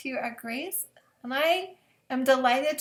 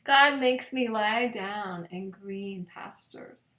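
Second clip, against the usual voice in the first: slow, reverent psalm reading with long pauses; 3 semitones lower than usual. Acted. Pastor reading Psalm twenty-three at bedtime, slow and reverent. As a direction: let each verse land gently, slowly.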